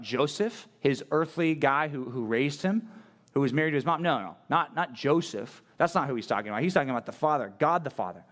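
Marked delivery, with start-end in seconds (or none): none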